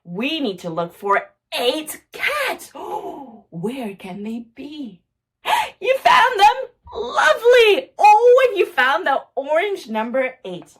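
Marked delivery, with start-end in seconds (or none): 2.7-5.0 hushed wonder. Pretend. Hushed search for cats.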